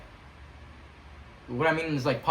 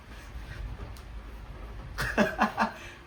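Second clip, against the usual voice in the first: giggle; 7 semitones higher, 6 dB quieter than usual. Real, not acted. Laughing after prayer story.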